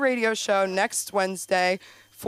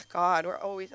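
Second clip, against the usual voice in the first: ordinary delivery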